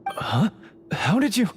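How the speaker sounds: a sudden jump up in pitch; startled. Acted. Plotter learns his trap was found, startled. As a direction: caught off guard, voice leaps. Explosives already dismantled.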